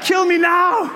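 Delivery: high, strained cry of dread; mock desperate plea. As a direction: beg for it to end, hammed-up. Acted plea at coaster top.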